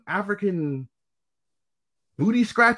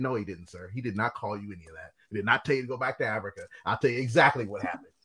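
lower, softer, level and reassuring; calm coworker; 10 semitones lower, 8 dB quieter, faster than usual. Retold or imitated. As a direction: settle the customer down calmly. Coworker calmly defends him to customer.

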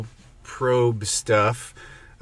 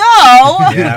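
loud yelp; 17 dB louder than usual. Real, not acted. Sudden loud yelp of agreement.

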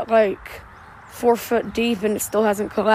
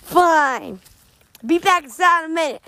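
Random Fact Joe voice; 6 semitones higher than usual. Acted. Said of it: "And I'm Random Fact Joe".

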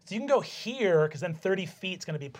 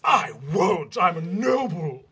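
haughty vampire; 4 dB louder, slower than usual. Acted.

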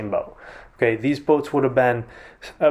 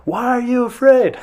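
much higher and louder, a full shout; yelled over the wind; 12 semitones higher, 6 dB louder than usual. Shown as a demonstration. Jesus shouting to the disciples over the wind, loud yell. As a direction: shout the question across the storm. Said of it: "he probably yelled it 'cause".

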